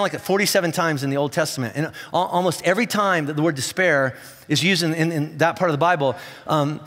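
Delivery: ordinary talk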